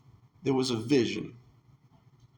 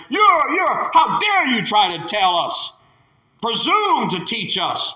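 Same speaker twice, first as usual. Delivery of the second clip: scornful, rising to louder outrage; indignant condemnation; 5 semitones higher, 4 dB louder than usual. Acted. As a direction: look down on him and scold. Pharisees condemn the man.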